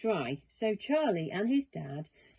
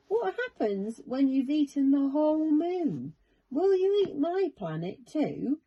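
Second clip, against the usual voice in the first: put-on higher, worried child voice; worried boy; 7 semitones higher, 4 dB louder than usual. A narrator's reading. Charlie fearing for his planet, high worried voice. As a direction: fret about it like a scared boy. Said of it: "Charlie worried".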